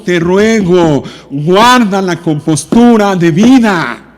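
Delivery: high, loud, impassioned pleading; urgent pulpit cry; full-force preaching. Real, not acted. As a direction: rebuke the congregation with ringing, drawn-out stresses.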